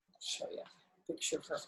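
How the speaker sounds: hushed aside to the listener; soft unvoiced aside; whisper. Real, not acted. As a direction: whisper it, breathy and close.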